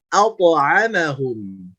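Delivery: slow "at'amahum" starting as dark "auto"; thick bouncing to. Shown as a demonstration. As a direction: draw out "at'amahum", heavy bounced t. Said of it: "tapi auto". ("at'amahum" pelan dan tebal)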